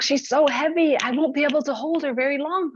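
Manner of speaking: a goose struggling to carry her; straining goose. Acted. Goose carrying Sky Woman, high strained voice.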